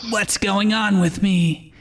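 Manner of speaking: worried inner voice, gently played; lightly voiced Ash. Ash's inner voice, narrated fic.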